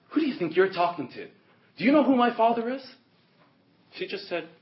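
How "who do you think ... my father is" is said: high, loud pretend outrage; hammed-up offended retort; mock indignation. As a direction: act affronted, pitch climbing sharply. Acted indignant retort she never made.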